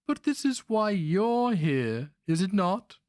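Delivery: put-on high, needling voice of a scheming old master; sly old sorcerer. A narrator's reading. Old Grandmaster needling his captive barbarian, high and sly. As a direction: tease the captive with silky menace.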